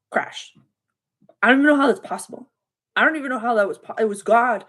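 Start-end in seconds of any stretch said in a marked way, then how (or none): 1.4-4.6 fast disbelief. Real, not acted. Disbelief at the site crash.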